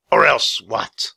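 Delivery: slow, loud, raspy challenge; raspy king mocking a threat; scornful villain. A narrator's reading. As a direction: dare them, drawing out each word.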